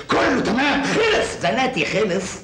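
high, triumphant, drawn-out gloat. Acted. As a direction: crow over the finished job. Gloating over the plan. (شماتة وانتصار)

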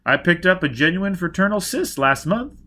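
bubbling collector's enthusiasm; enthusiastic. Acted. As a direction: launch keenly into news of a prize find. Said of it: "Baker began enthusiastically".

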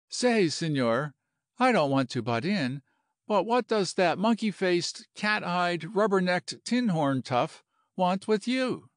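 a touch of sassy hotel woman; lightly voiced brash landlady. Landlady asking the general about that tough, lightly sassy. Voices Missus O'Brien's blunt question.

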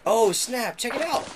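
high, loud excited call; excited find. Real, not acted. Gamer finding loot, very high and excited. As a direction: burst out at the loot. Finds a magic mirror.

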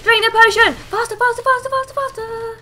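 high, loud, panicky urging; urgent shouting. Real, not acted. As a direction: shout it urgently, hurrying the character along. Urging game character to hurry.

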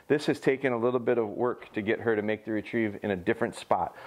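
ordinary talk, nothing marked

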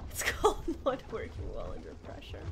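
breathy unvoiced repeat after a laugh; whispered laughing repeat. Real, not acted. Streamer repeating her excuse to chat in a laughing whisper. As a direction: whisper it again, amused.

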